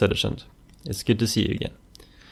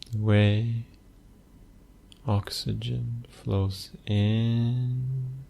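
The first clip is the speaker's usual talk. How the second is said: soft, unhurried meditation voice with long pauses; hushed, even delivery, letting phrase ends fade; guided meditation; 5 dB quieter, slower than usual. Acted. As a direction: guide the listener gently into stillness, phrase by phrase.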